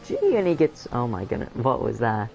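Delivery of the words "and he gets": voice cracking. Real, not acted. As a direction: voice cracks up high mid-call. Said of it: "that voice crack".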